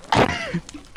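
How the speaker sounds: high nervous shriek of laughter. Real, not acted. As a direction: burst out laughing after a scare. Burst of laughter after scare.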